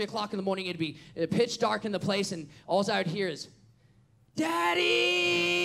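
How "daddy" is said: put-on high, loud child's cry for his father; child yelling Daddy. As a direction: call out like a scared kid in the dark. Son screaming in the night.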